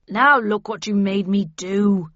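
slow, loud growl of annoyance; irritated man's growl, drawn out. A narrator's reading. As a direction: growl the blame at them. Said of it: "he growled".

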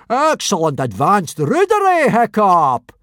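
loud, high-pitched bellow; hearty roar. A narrator's reading. Teacher delighted at a pupil's rudeness, roaring. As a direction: roar the praise out. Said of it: "roared Gobber the Belch".

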